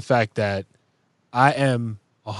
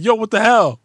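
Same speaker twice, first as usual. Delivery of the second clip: much higher, louder mock yell; imagined angry outburst; 10 semitones higher, 5 dB louder than usual. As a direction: snap at someone who hurt you. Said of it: "you would have been like".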